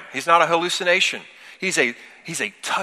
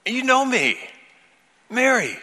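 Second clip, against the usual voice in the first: high, intimate voice of recognition; tender calling; 9 semitones higher than usual. Pretend. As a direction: call her name like someone who knows her. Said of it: "in a way that communicates".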